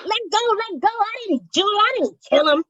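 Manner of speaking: high, rushed pleading; panicked denial. Acted. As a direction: squirm free and plead innocent.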